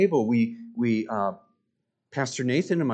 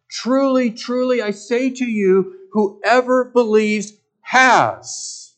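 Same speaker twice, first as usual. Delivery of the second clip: "Truly, truly" hit much higher and louder, then slow and drawn out; emphatic repetition; 8 semitones higher, 6 dB louder, slower than usual. Acted. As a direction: preach each word slowly and loudly. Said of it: "He's emphasizing with that double repetition".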